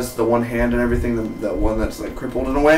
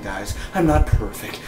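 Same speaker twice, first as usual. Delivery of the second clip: usual speaking voice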